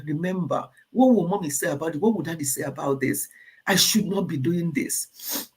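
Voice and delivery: ordinary delivery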